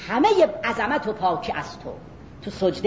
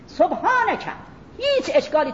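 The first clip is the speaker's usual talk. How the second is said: high exclaimed سبحانک, pitched well up; a prophet's cry from the dark; crying out to God; 8 semitones higher than usual. Retold or imitated. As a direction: call out سبحانک as a desperate plea. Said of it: "فریاد بندهٔ بیداری است".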